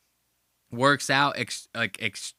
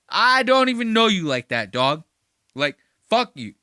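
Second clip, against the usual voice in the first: louder retold rebuff, starting high; mock angry call-back; 7 semitones higher, 7 dB louder than usual. Host acts Nate calling him back, mock-angry. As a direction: imitate a blunt, punchy brush-off. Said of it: "Straight up fucking calls him like".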